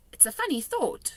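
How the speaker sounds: a hint of a young girl; Sophie's voice. A narrator's reading. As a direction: read her as a polite small girl.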